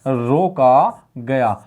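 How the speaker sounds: very slow, stretched syllables; slow dictation. Shown as a demonstration. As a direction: draw out रोका, pause, then गया. Teacher repeats answer for dictation.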